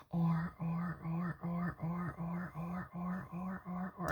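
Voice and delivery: fading into a whisper; murmured whisper dying away. Real, not acted. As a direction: trail off into soft whispers. Whispered repetition while channelling.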